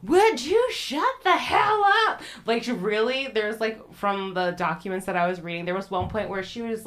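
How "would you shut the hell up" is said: high, loud mock outrage; exasperated yell. Accused woman to screaming girls, exasperated mock yell. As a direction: yell it as a fed-up retort, pitch way up.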